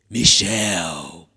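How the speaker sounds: low, loud, drawn-out prank call; dramatic silly call. Retold or imitated. Prankster priest creeping up behind her, dramatic and silly. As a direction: boom her name slowly, hamming it up. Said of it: "I was being dramatic".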